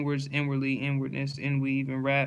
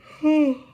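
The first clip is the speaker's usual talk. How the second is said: high, loud exhaled "whoo"; big high-pitched puff of relief; loud sigh; 13 semitones higher, 9 dB louder than usual. Real, not acted. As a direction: let out a sharp, high sigh. Loud breath out during word list.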